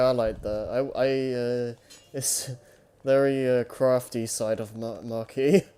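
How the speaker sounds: ordinary talk, nothing marked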